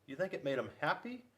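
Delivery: plain reading voice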